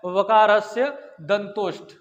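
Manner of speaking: वकारस्य दंतोष्ठ spelled out slowly, syllable by syllable; slow, deliberate recital of the sutra वकारस्य दंतोष्ठ; slow dictation. Shown as a demonstration. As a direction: dictate the rule slowly so students can note it. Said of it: "महत्वपूर्ण word जो है वो कौन सा है".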